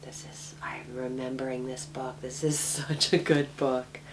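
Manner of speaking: quiet whispered aside to herself. Real, not acted. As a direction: hush it, almost to yourself. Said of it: "aside whispered".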